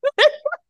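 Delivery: very high squealing laugh; hysterical laugh. Real, not acted.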